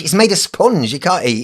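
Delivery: high loud puppet voice; squeaky dummy protesting. Acted. Ventriloquist answers for puppet.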